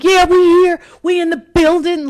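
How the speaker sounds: high, loud club DJ announcing. Retold or imitated. Drunk woman arriving at the club table, loud DJ hype. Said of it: "I'm in my D J voice".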